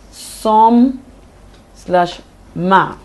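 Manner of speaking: "Some" stressed hard, "ma" set apart; stressed-unstressed demo. Shown as a demonstration. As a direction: punch "Some", pause, then "slash ma". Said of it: "one stressed syllable is followed by".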